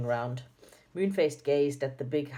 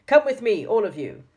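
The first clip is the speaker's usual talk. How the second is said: put-on much louder, higher stern old woman's command; Dame Snap frightening voice; 6 semitones higher, 9 dB louder than usual. Dame Snap ordering all the children to follow, loud and frightening. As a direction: bark the order to scare them all. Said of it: "in such a loud and frightening voice".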